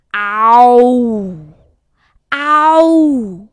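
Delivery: loud roaring tiger growl. Acted. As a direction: roar like a big tiger. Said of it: "大老虎说". (老虎的吼叫声)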